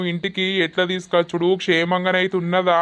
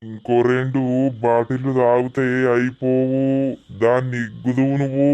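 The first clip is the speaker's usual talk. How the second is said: lower, menacing tipsy lord; drunken villain; 5 semitones lower than usual. Acted. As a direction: threaten the captive. Villain voice held.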